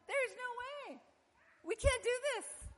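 high, keening lament of the sinful people; wailing despair. Retold or imitated. People at the foot of the wall, wailing in despair.

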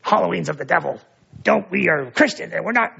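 put-on voice of a preachy objector; mock the finger-wagging believer. Pastor parodies Christians who condemn Halloween, preachy. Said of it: "we don't go around saying".

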